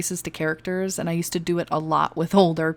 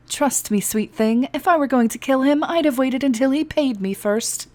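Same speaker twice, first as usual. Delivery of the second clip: high, louder wry teasing; 7 semitones higher, 4 dB louder than usual. Acted. A smuggler teasing the guard about murder. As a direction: tease him with a crooked grin. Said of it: "She cracked a wry smile".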